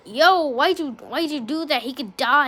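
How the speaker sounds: retold indignant outburst; alarmed outrage. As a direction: call the bully out, appalled. Retold confrontation with the bully.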